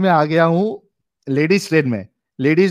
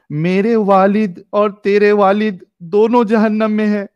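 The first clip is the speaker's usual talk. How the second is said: slow, raised, ringing reading; emphatic recitation; 6 semitones higher, 3 dB louder, slower than usual. A narrator's reading. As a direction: read it out slowly and emphatically, pitched high. हदीस का अहम जुमला पढ़ना. (ज़ोर देकर, ठहर-ठहर कर ऊँचे सुर में पढ़ना)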